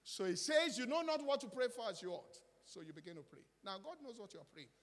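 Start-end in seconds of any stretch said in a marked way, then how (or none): 0.0-2.4 much louder and higher, rousing; preaching at full force. Acted. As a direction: fire up the congregation.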